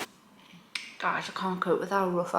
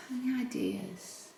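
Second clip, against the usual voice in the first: soft whisper, higher pitch, slow; whispered aside; 6 semitones higher, 8 dB quieter, slower than usual. Real, not acted. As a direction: breathe the question out softly. Whispered question to viewers.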